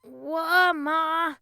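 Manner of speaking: high, loud, drawn-out call; calling back. A narrator's reading. Boy answers as if called from play, calling back. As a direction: holler back to Mom. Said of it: "then called back".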